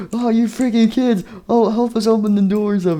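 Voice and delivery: high-pitched puppet in a panic; frantic plea. Acted. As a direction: cry for help high and frantic. Puppet begs for help in story.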